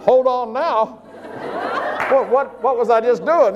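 high, loud mock disbelief; incredulous reaction. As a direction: retell a startled thought, pitched right up. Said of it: "I thought".

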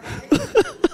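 squeaky high laugh. Real, not acted. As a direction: laugh at the joke. Laughing at the punchline.

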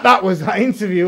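said through a laugh. Real, not acted. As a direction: chuckle while you say it. Laughing over the interview.

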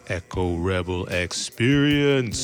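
slow, low announcer drop. Acted. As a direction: boom the show name out slowly. Show name announced by co-host.

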